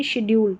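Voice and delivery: शेड्यूल said shed-yool, falling; शेड्यूल as the correct sh form; right pronunciation. Shown as a demonstration. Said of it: "शेड्यूल करके इसका उच्चारण हो रहा है".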